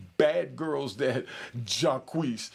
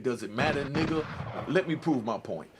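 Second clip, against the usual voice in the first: usual speaking voice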